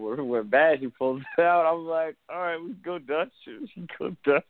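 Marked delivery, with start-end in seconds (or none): 2.3-4.4 higher-pitched quoted reply with a laugh; jokey, high-voiced concession; mock agreeable reply. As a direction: act out giving in, pitched up. Acts out his reply on bad date.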